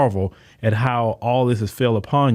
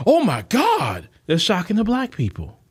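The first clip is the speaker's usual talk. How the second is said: hammed-up pearl-clutching; mock shock; 6 semitones higher than usual. Mocking imagined shocked reaction.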